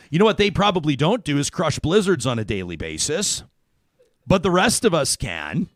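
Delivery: upbeat, bright-paced ad read over music; radio ad read. Acted. Host pitching Dairy Queen Valentine's specials to listeners, upbeat ad read. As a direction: sell the blizzard specials to listeners.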